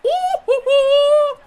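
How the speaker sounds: high, loud celebratory whooping; drawn-out high hoots of excitement; excited whoop. Real, not acted. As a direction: whoop it up at full volume. Celebrates a play.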